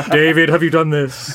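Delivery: mimicking a nagging colleague; Nick Briggs impression. Director imitating his colleague checking on him, put-on voice. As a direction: put on your co-host's voice as he checks up. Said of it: "the voice I hear in my head is yours, Nick, going".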